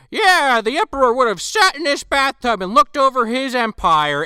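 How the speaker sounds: hammed-up documentary host. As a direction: present it like a TV host. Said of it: "he's like".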